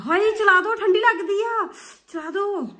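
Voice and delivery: high, loud whiny plea. Real, not acted. Pleading to turn heat on.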